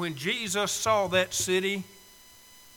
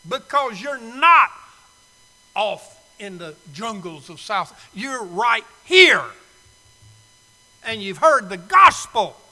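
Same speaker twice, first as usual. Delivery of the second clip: loud, higher pulpit preaching; preaching at full force; 5 semitones higher, 10 dB louder than usual. Acted. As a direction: drive it at the congregation.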